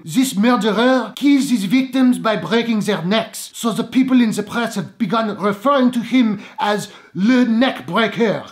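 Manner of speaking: mock French news reporter, slow; terrible French accent. Imitating a movie news reporter in a bad French accent. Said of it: "with a terrible French accent".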